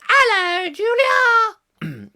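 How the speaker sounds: very high, squeaky hello; high-pitched greeting. Pretend. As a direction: greet her in a silly falsetto. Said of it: "He doesn't do it in such a high-pitched voice".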